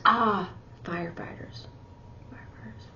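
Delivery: hushed realisation; whisper. Pretend. Sign teacher whispering a sudden realisation in an example, whispered.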